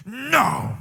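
put-on a pouty little kid's sulky no; pouting no. Toddler pouting at his mom, sulky pouting no. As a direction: lips pushed out, sulking. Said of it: "puckers out his lips like".

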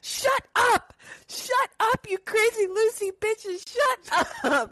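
high shouted shut up, laughing through; hammed-up screaming shut up; mock angry yell. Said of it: "Cuba Gooding Junior goes".

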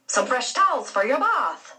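light, lilting Irish steward; Irish accent. A narrator's reading. Steward Henry offering towels, in a light Irish lilt. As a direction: sing it out like a jolly Irish steward. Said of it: "an Irish accent that made everything he said sound like a jolly song".

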